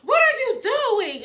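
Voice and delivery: loud, high, drawn-out imitation wailing; mock crying yell. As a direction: imitate a frantic ex wailing her question. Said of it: "And she's crying".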